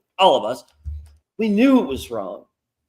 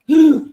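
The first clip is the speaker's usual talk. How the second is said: mock shocked gasp; 10 semitones higher, 6 dB louder than usual. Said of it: "when it was like".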